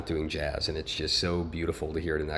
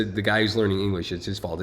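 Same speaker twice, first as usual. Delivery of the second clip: ordinary talk, nothing marked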